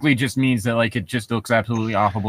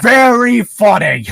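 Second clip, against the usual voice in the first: slow, high, loud deadpan; hammed-up dry sarcasm; sarcastic; 9 semitones higher, 6 dB louder, slower than usual. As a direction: drag it out, unimpressed. Woody's sarcastic reply.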